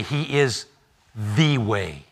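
the way dropped low and slow; stress on the way. Acted. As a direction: land on the way deep. Contrast "a way" vs "the way.

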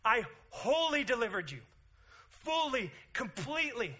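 high, loud, emphatic declaration; God's voice. Retold or imitated. God reminds his people, loud and emphatic. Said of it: "He's going".

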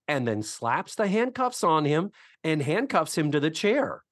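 usual speaking voice

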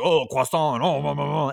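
loud, hammed-up French caricature; mock French person. Comic demonstrating a French act to his writer, mocking. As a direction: babble like a cartoon French person. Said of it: "maybe a Canadian French person".

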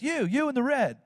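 single out the one in red; mild imitation of a preacher lady calling someone out; prophetess calling out. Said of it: "this lady says".

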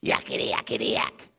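lightly voiced demon yakking, hushed; imitating a little demon's babble; a hint of a shrill demon jabbering; mock demon chatter. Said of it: "crying in a shrill voice".

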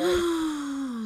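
sharp surprised gasp. Real, not acted. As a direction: gasp in shock. Reacting to a shocking plot point.

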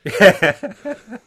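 a sudden loud burst of high laughter. Real, not acted. Laughing hard at his co-host's mock accent. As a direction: crack up loudly.

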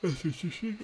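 hablando mientras bosteza; yawning. Real, not acted. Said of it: "Please don't let my yawn".

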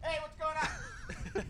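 distant, high call from far off; far away shout. Shown as a demonstration. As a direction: shout from across the street. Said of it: "I'm gonna put my microphone far away".

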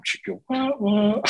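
high mock porn riff, chicka wah wah; immature porn-music noise. Telling his co-host about his immature side, high mock music riff. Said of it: "this little immature part of me go".

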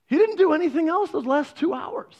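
high-pitched, incredulous complaint; can't believe the wasted time; exasperated disbelief. Retold or imitated. Retelling exasperation at friend's failed help.

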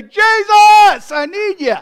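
huge, high shout; full-voiced desperate call; crying out. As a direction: open up and holler for help. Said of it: "We open up our big pie hole".

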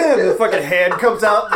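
ordinary talk, nothing marked

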